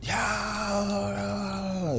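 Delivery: high, long, stretched battle cry; mock war cry charging into the fight scene; wild yell. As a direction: let out a drawn-out wild-man yell. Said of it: "I just go in".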